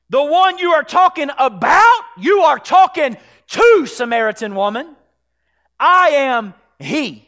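loud, high preacher's declaration; full-force preaching. Real, not acted. Preacher proclaiming Jesus as the great I am, full-force preaching. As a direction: hammer each key phrase with force.

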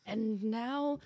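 lightly acted villain monologue; Andrew Ryan. Villain starting his audition monologue, lightly theatrical. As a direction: open a villain's speech with measured drama. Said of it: "villainy role".

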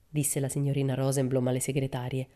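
ordinary delivery, nothing marked

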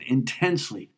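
ordinary delivery

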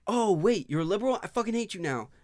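mock sudden hatred. Acted sudden hostile friend.

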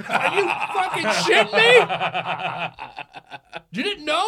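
very high, loud disbelief through laughter; incredulous mock yell. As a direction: imitate shocked friends, hollering and laughing. Friends react to married-woman punchline.

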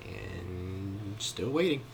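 slow, drawn-out and hushed; long-suffering, softly stretched words; weary waiting. Acted. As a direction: drag it out, patience wearing thin. Acting out months of waiting.

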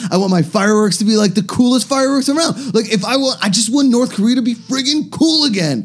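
put-on high, wistful wannabe cool kid; eager put-on boy voice; Kim Jong-un. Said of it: "Kim Jong-un wants to go to".

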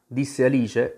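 usual speaking voice

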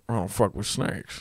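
lightly voiced hero, low and deadpan; deadpan mock impression, pitched low; Han Solo. Comic repeating his snake punchline on request, lightly voiced and low. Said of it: "give me Han Solo".

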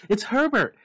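high, loud desperate scream. A narrator's reading. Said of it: "she screamed".

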